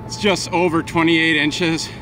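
breathless, thrilled angler showing off a prize; out of breath, excited. Real, not acted. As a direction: talk through heavy breath after the big catch. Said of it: "I'm a little out of breath, both from excitement".

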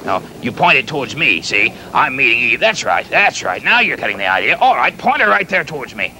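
usual speaking voice

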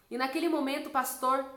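usual speaking voice

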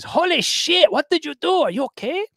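high, alarmed quoted wife; wife's alarmed voice. Retold or imitated. Wife finding him bloodied, alarmed and high. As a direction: gasp it out like a shocked partner. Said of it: "She says".

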